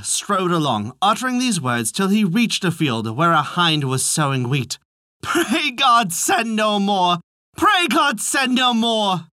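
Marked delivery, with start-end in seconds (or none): 5.2-9.3 high, very slow chanted call; high singsong street cry; drawn-out cry. A narrator's reading. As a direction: cry it out word by stretched word. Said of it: "This was all his cry".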